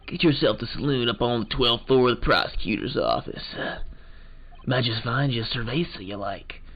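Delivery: lightly voiced old-west detective, easygoing; cowboy detective.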